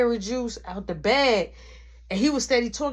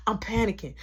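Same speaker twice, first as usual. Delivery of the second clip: usual speaking voice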